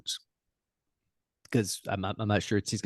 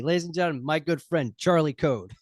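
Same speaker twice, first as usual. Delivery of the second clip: much higher, sing-song announcer call; guest introduction; 8 semitones higher than usual. Acted. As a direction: present him like a ring announcer. Host presents the guest.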